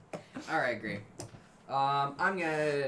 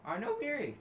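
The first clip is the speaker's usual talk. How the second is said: voice crack; 10 semitones higher than usual. Acted. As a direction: voice cracking high on know. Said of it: "Voice crack".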